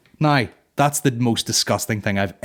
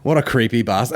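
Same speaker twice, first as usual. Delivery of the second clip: usual speaking voice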